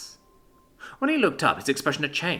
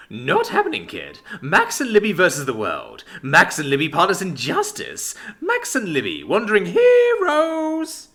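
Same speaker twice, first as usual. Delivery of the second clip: grand proclamation; 7 semitones higher, 6 dB louder than usual. Acted.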